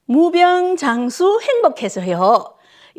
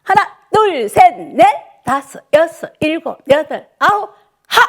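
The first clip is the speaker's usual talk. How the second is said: high, louder count with a shouted finish; calling the count; 4 semitones higher, 4 dB louder than usual. Real, not acted. 어르신들 박수에 맞춰 크게 외치는 구령. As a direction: drive the class through the claps. Instructor calls clap count.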